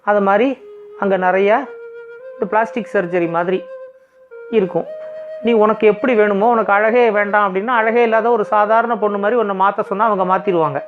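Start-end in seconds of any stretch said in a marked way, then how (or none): none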